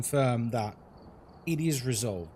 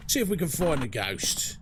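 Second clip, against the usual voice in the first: playful. Shown as a demonstration.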